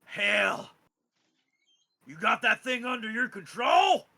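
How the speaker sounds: loud, high incredulous shout; surprised father. Acted. Father hearing his son controls the dragon, shouting in surprise. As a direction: bark it out in disbelief. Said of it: "He goes".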